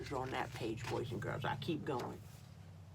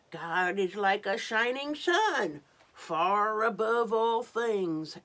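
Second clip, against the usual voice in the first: higher, louder, slow and grand; blue sky booming; grand proclamation; 4 semitones higher, 7 dB louder than usual. Acted. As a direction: proclaim from on high. Sky character speaking grandly.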